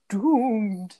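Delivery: a lightly voiced drawn-out Doomed; long dragged-out doomed. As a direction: stretch out Doomed like a creepy omen. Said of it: "long, dragged out".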